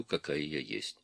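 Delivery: usual speaking voice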